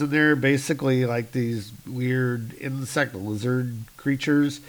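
normal talking voice

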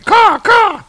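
very high loud cawing; raven caw. Acted. Munin the raven guiding Baldur, loud high caw. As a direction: squawk like a raven. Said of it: "And Munin's going".

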